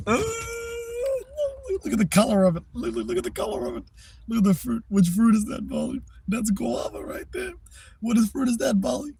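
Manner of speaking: high, cooing voice showing the cat fruit; pet baby talk. Acted. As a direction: coo at Molly like a toddler.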